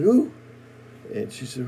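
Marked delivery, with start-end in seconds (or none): 0.0-0.3 startled high yelp, much louder; sudden scared shriek of a question; frightened cry. Acted. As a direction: jump at the stranger at the door. Woman startled by stranger.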